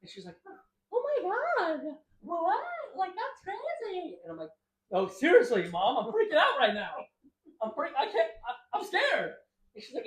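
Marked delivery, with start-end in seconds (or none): 0.9-4.2 put-on very high, drawn-out drunk mother; squeaky, slurred mom impression. Drunk mom voiced in story. 4.9-9.3 loud, rising, laughing fright; loud, high, giggling mock fear; laughing mock panic. Panicking to mom while high.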